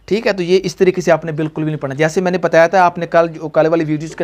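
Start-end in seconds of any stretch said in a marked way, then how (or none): none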